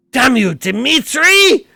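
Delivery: loud, high, slow yell; furious shout. Acted. Anna curses her husband Dimitri, furious and loud. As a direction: curse him at full voice.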